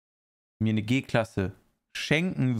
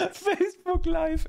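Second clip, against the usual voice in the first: "Facebook Live" squeaked much higher and drawn out slowly; mock squeal; slower than usual. Shown as a demonstration. Streamer repeating his own Facebook Live joke, in a high, slow mocking squeal. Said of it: "ich würd gern zu Facebook Live".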